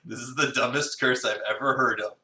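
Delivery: usual speaking voice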